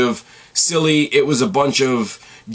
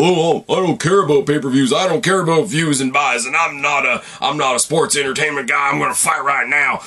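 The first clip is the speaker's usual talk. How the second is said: mock Texan accent; 4 semitones higher than usual. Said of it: "I don't know why he's a Texan all of a sudden".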